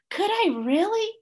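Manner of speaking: very high wonder; can hardly believe it; delighted disbelief. A narrator's reading. Wombat thrilled.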